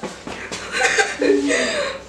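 high breathless laugh. Real, not acted. Laughing at friend's stuffed mouth.

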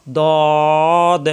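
"দদ" heavy and rounded, held long; thick dad. Shown as a demonstration. Teacher modelling the letter, "দদ" thick and drawn out. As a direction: stretch a full, thick "দদ". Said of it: "মোটা করে উচ্চারণ হবে".

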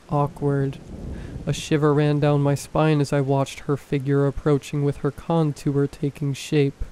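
ordinary delivery

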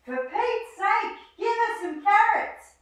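high, exasperated wombat whine; exasperated plea. Acted. Wombat pleading with humans for carrots, exasperated and high. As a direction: beg for carrots, fed up.

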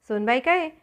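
ordinary delivery, nothing marked

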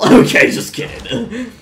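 laughing through the words. Real, not acted. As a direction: giggle while talking. Laughs at own joke.

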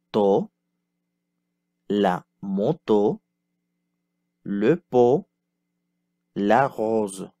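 careful, slightly slowed teacher repeat; slow model repetition. Shown as a demonstration. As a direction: say each word clearly for the class to copy. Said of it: "Répétez".

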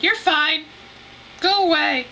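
high, dismissive put-on voice; imitated ambulance driver. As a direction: brush them off as the driver. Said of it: "the ambulance driver was all like".

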